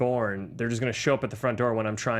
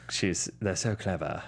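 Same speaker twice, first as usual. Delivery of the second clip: low, slow and soft imitation; velociraptor expert from Jurassic Park; 7 semitones lower, 5 dB quieter than usual. Podcaster imitating the actor's Jurassic Park awe, low and slow impression. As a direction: savour it slowly in a lowered film-character voice. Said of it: "how he would talk about a velociraptor".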